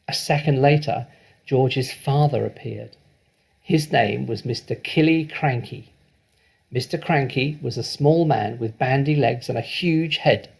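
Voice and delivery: ordinary delivery, nothing marked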